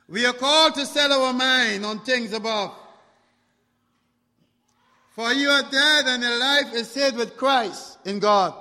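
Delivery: loud, high scripture proclamation; full-force preaching. Acted. Preacher calls listeners to look above, preaching hard. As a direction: ring it out to the whole church.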